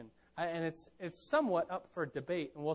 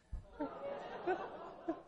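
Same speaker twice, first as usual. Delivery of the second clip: breathy high laugh at the joke; airy laugh; 13 semitones higher, 7 dB quieter than usual. Real, not acted. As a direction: let out a soft airy laugh.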